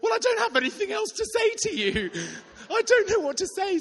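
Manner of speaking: high, loud, laughing through the words; giddy with disbelief. Real, not acted. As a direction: caught between a laugh and tears. Laughing disbelief after the hit.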